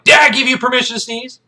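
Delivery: high, loud interrogation; staring him down. Acted. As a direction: shout it into the cadet's face. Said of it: "he stares this kid down like".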